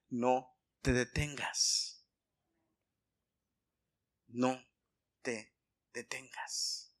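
slow, low and deliberate, with pauses; solemn title announcement. Real, not acted. Preacher announces tonight's sermon title, slow and solemn.

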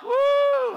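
high, loud "woo"; real revival-meeting holler; exultant whoop. Real, not acted. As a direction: let out a piercing praise whoop. Whoop amid led laughter.

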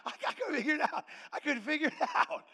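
high, loud comic bewilderment; exasperated bafflement. Retold or imitated. Husband cannot understand his wife's tears, loud bafflement. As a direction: cry it out twice, hammed-up and baffled. Acted bafflement at crying wife.

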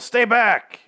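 high, loud retold yell. Carol to zombie Tobin as she throws him back, yelled. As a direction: shout it as a warning. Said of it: "and she yells".

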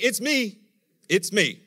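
high, loud insistent claim; Jesus announcing. Retold or imitated. Preacher voicing Jesus declaring himself, loud high. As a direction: point to yourself, insisting. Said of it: "He's like".

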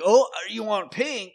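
high, loud eager clerk; eager salesman. Retold or imitated. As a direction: pitch it up like a pushy seller. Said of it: "he's like".

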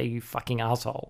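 ordinary talk, nothing marked

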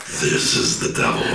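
low whispery demonic voice, laughing; devil voice. Acted. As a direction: growl it like the devil. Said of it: "Fucking devil voice".

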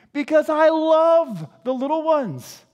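emphatic declaration. Acted. Jesus declaring love, loud take.

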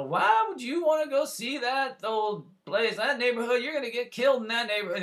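imitating an elderly Southern woman, high and slow; a sweet old Southern lady fretting, drawn out; high Southern granny voice warning him off; slow Southern drawl. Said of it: "in that slow Southern drawl".

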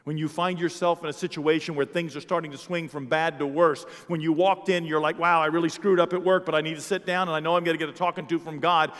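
loud, fervent sermon story; excited sermon anecdote; fired-up preaching. Acted. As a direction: tell it with preacher's fire. Preaching at full force.

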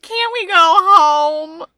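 slow, loud mock whine; whiny shark voice. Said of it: "He's like".

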